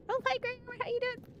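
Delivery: squeaky little hello; high-pitched greeting. Real, not acted. Greets a viewer mid-panic.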